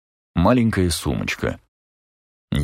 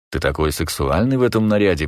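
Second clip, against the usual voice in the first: plain reading voice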